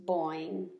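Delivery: low, soft drooping boing; a deflated frog call; disappointed boing. A narrator's reading. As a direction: let the boing sink.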